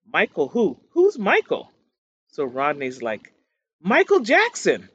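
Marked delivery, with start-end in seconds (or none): 0.1-1.7 mock bafflement, louder on the second question; baffled question. Retold baffled reply to caller. 3.8-4.9 high, loud retold exclamation; excited reveal.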